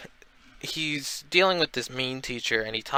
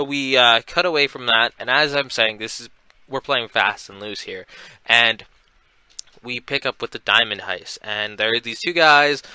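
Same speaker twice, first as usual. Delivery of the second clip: ordinary delivery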